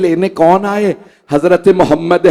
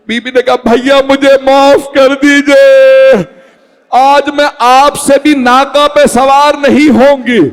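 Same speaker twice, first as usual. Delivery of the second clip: lamenting high and loud, drawn-out cries; full-force preaching; 8 semitones higher, 5 dB louder, slower than usual. Acted. Preacher to the mourning crowd, in a high, loud, drawn-out lament. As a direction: wail it out to the mourners, building to a peak. Majlis climax, preaching the farewell.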